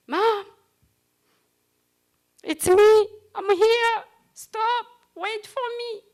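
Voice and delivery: high, loud desperate call; screaming for mom. Retold or imitated. To her mother running away, high pleading screams. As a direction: scream after her as she runs off.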